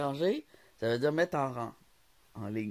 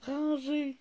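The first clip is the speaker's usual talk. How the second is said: incredulous echo; 14 semitones higher, 3 dB louder than usual. Real, not acted. Repeats the word in disbelief.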